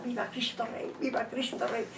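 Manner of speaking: soft, understated retold shouting; hushed mock yell. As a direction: report the cries softly, barely voiced. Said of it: "empezó a gritar". (grito imitado en voz baja)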